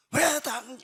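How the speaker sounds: high-pitched mock indignation; retold indignant retort. Wife objecting to being yelled at, mock indignant retort.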